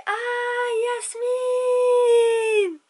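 high, syrupy sweet-talker voice; mielleux. Retold or imitated. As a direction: coo her name like a smooth flirt. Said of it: "Il était mielleux".

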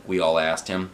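usual speaking voice

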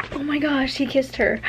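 high, giddy disbelief; thrilled shock. Real, not acted. Reader announces the kiss to viewers, thrilled shock. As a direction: say it reeling from the surprise.

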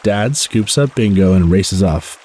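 plain reading voice

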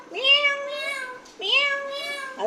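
high, long drawn-out meow; stretched mock kitten meow; cat meow. Imitating a cat for child.

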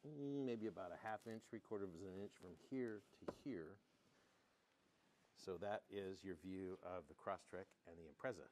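golf announcer voice. Pretend. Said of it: "using our golf announcer voice".

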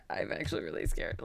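hushed, nearly whispered admission of fear; small, scared undertone; scared confession. Real, not acted. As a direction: drop to a nervous whisper. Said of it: "I'm scared".

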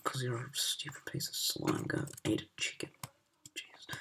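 soft whispered jab; muttered grumble at the game; whispered insult. Real, not acted. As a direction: hiss the insult under your breath.

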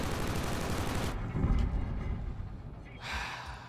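long, soft, voiceless exhale; long sigh. Real, not acted. Player after blaming teammates, long weary sigh. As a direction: let out a drawn-out sigh.

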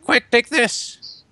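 urgent hand-off. Acted. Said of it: "I'm like".

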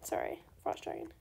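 whispered apology; soft whispered sorry. Real, not acted. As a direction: breathe it softly to the pet. Aside to the frost dragon.

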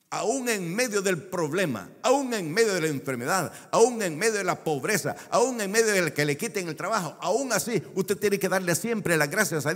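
preacher climbing to a peak; building preaching. Acted.

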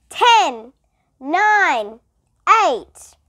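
loud countdown numbers; shouted countdown. A narrator's reading. Principal counting down for Stephen, loud. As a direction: bark out each number. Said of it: "He had to shout the numbers".

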